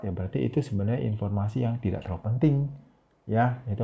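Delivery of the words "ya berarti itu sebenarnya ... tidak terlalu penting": rattled off quickly; fast speech. Shown as a demonstration. Teacher showing how fast talk sounds unimportant, rushed. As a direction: rush it like throwaway info. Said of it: "kalau kita bicaranya cepat".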